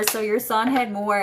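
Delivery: ordinary talk, nothing marked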